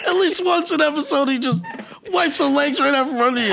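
high voice breaking into a laugh; lightly voiced, laughing wife; mock wife voice.

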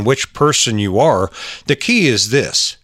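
ordinary delivery

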